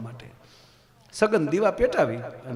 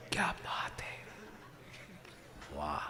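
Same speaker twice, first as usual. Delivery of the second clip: whisper; 9 semitones lower, 16 dB quieter than usual. Acted. Admiring aside whispered.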